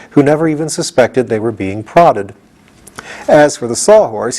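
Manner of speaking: ordinary delivery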